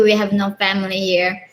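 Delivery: ordinary talk, nothing marked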